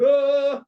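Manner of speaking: high, loud "Ah!" pushed too hard; strained high yell. Shown as a demonstration. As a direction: belt out a voice-wrecking cry. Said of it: "They can't just blow their voices out going".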